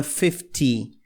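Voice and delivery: fifty with a clear T; T kept. Shown as a demonstration. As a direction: keep the T crisp in fifty. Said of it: "aqui o T se mantém". (fifty com o T mantido)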